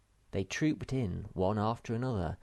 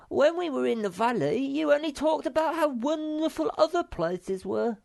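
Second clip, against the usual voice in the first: very high, louder, whiny little creature; squeaky Sniff; 6 dB louder than usual. Acted. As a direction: complain in a tiny squeaky voice. Sniff complaining.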